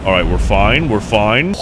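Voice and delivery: ordinary talk, nothing marked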